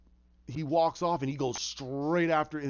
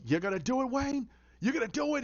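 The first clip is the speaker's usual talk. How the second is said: high-pitched goading questions; taunting villain; 8 semitones higher than usual. Retold or imitated. Beaten Penguin taunts Wayne, high and goading. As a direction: goad him, pitch climbing high.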